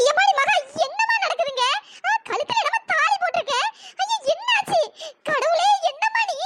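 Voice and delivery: put-on high, tearful old woman's voice; distressed grandmother. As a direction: wail it like a granny who just found a shock. Grandmother sees the thali.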